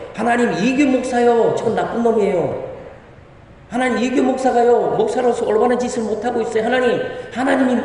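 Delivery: high-pitched complaint, telling on someone to God; mock tattling prayer. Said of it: "이렇게 하나님 앞에 고자질을 하시라니까요".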